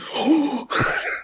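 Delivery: high mock-awestruck gasp; soyjack gasp. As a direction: gasp like an overexcited fanboy. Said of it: "like the soy jack".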